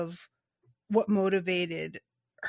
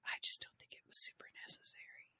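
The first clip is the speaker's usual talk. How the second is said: sudden hushed whisper, much softer; whispered aside; 18 dB quieter, much faster than usual. Real, not acted. Host whispers a guilty opinion to the listeners. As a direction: drop to a whisper for the confession.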